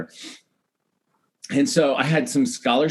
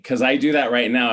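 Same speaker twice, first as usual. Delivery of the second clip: usual speaking voice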